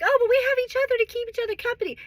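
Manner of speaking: high mock voice of the wives. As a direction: mimic them sweetly, mocking. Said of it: "they're like".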